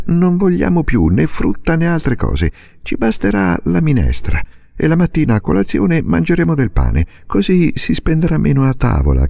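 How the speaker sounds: a daughter firmly persuading her mother; young girl Silvia. A narrator's reading. Narrator voices Silvia. (bambina decisa che convince la madre)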